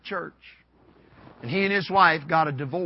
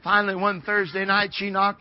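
ordinary talk

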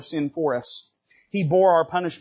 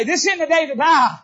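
higher, louder, laughing joy; joyful shout; 10 semitones higher, 7 dB louder than usual. Acted. David rejoices over mercy, loud and laughing. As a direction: exult in the victory. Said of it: "I've won the victory today".

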